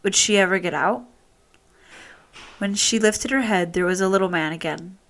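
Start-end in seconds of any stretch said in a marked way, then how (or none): none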